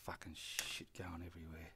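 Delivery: hushed cursing under the breath; unvoiced whisper to himself; whispered aside. Real, not acted. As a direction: whisper it so the camera won't catch it. Muttering aside while filming.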